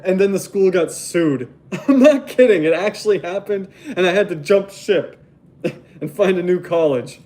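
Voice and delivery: can't stop laughing at the absurdity. Real, not acted. Tells viewers the school got sued, laughing throughout.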